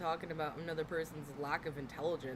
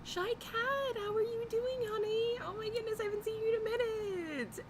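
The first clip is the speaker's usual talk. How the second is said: put-on much higher, sing-song baby-talk voice; high, sweet pet-talk hello; cutesy greeting; 3 dB louder than usual. Greeting a viewer named Shy Cat.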